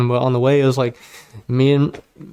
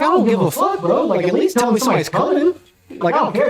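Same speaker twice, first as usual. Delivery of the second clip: much higher, heated complaint at friends; retold angry outburst; 8 semitones higher than usual. Confronting bunkmates who let him get blindsided, angry retold outburst. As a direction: snap at them for not warning you.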